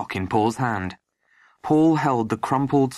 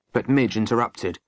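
ordinary delivery

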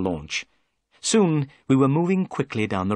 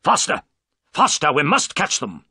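louder, urgent orders; urgent shout; 4 semitones higher, 5 dB louder than usual. Acted. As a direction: push the crew to go faster. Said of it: "cried Holmes".

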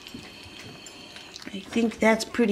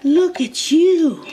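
higher coo; 8 semitones higher, 5 dB louder, much slower than usual. Real, not acted. Owner gushing at her eating dog, high slow baby talk. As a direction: lift it up high and stretch it for the dog.

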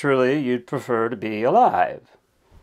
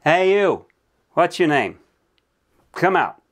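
higher, louder summons through a lid; calling out; 7 semitones higher, 5 dB louder than usual. Acted. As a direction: call out sharply to someone shut inside. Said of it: "somebody is gonna bang on this lid and they're gonna tell me to come out".

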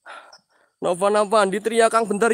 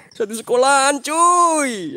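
excited; 7 semitones higher, 5 dB louder, slower than usual. Real, not acted. Hyping Genji's rampage.

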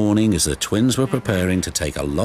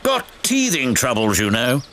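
flustered diesel; 13 semitones higher than usual. A narrator's reading. New diesel excuses his breakdown, hot and bothered. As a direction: start high and harassed, settle as you explain.

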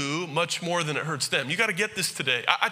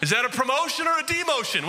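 a high, far-off cry of mock disbelief; incredulous pal; 8 semitones higher than usual. Repeating the cashier's word pal in mock outrage. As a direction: play up the insult as a joke.

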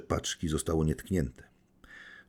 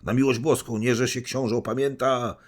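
much higher, loud pleading cry; calling out; 9 semitones higher, 5 dB louder than usual. Acted. General pleading with the prince on the stairs, loud high cry. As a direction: beg him urgently to stop. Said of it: "zawołał".

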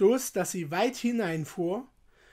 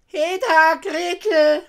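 very loud, high-pitched witch call; witch's shout; 9 semitones higher, 13 dB louder, slower than usual. A narrator's reading. Impatient witch summoning Gretel, shouting. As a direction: holler for the girl in a high witch voice. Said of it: "rief sie dem Mädchen zu".

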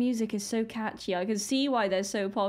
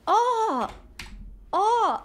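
startled cry; 11 semitones higher, 4 dB louder than usual. Real, not acted. Sudden surprise at the video.

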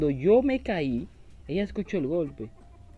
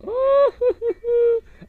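high, loud giggle; 8 dB louder than usual. Real, not acted. Player hiding under the bed, high giggle.

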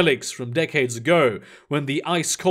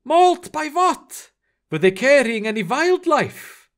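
high, disbelieving character voice; taken aback, voice raised in pitch; incredulous questioning; 9 semitones higher than usual. Acted. Eric questions how crew died.

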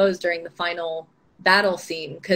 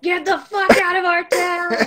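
high, loud imitation of angry locals; mock yell; 10 semitones higher, 4 dB louder than usual. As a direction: yell it like an annoyed townsperson, half in jest. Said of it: "they were like".

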